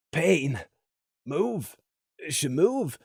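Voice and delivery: a lightly voiced weak, hurting murmur; faint words from a wounded pony; softly voiced struggling plea; Gloom's voice, wounded. A narrator's reading.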